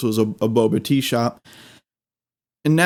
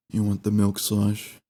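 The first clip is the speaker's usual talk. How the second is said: depressed cashier; 5 semitones lower, 6 dB quieter than usual. Retold or imitated. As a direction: drag it out like you hate the job. Cashier voiced in story.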